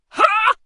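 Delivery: sudden loud yelp on impact; high, explosive "ha"; loud high cry. Acted. Boy takes the ball in the face, crying out. Kick lands on his face.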